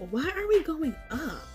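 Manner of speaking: high, confused asking; lost and puzzled, asking why; puzzled question. Retold or imitated. Confused in dream.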